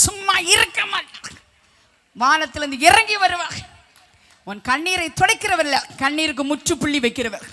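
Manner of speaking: loud, high rhythmic proclamation; full-force preaching. Real, not acted. Preacher promising the sorrowful God's help, forceful proclamation. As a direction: drive each promise out like a declaration.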